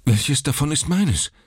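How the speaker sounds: high, urgent panted question; anxious father among the cribs; breathless. Acted. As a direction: gasp it out anxiously. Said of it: "keuchte Mister Button".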